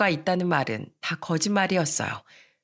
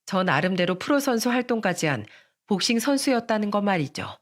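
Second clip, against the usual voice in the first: plain reading voice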